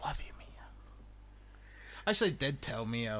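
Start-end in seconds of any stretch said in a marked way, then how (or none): 0.0-0.5 whisper. Real, not acted. Soft follow-up after whisper.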